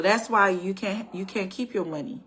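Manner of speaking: ordinary talk, nothing marked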